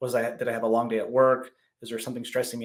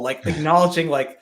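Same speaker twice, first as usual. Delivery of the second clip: ordinary delivery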